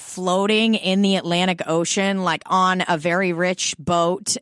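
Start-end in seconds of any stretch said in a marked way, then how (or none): none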